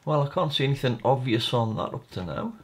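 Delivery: normal talking voice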